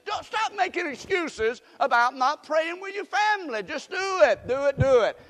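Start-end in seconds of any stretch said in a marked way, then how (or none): 3.6-5.2 exhorting. Real, not acted. Preacher exhorting congregation.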